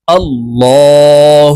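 আল্লাহুম্মা with a thick, dark "law", drawn out; heavy L. Shown as a demonstration. Teacher showing the correct word, heavy drawn-out "law" in আল্লাহুম্মা. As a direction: chant আল্লাহুম্মা with a full heavy L and held hum. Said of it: "ল বলে উচ্চারণ করতে হবে".

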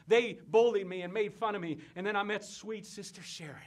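normal talking voice

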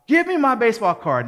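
high, loud mock yell; angry demand. Boy rushes to his friend's house for his card, angry demand. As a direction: shout it as an outraged kid. Confronting the friend who stole it.

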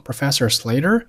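"Slater" swept upward as a question; rising tune. Shown as a demonstration. Teacher pointing out the tune to learners, rising intonation. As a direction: let "Slater" climb at the end. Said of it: "语调上扬".

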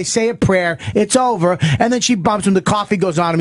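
ordinary talk

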